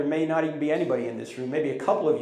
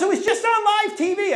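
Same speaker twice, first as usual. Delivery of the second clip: excited; 6 dB louder than usual. Retold or imitated. Pastor's excited reply.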